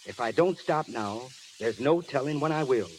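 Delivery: ordinary talk, nothing marked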